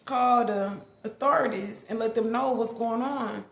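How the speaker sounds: ordinary talk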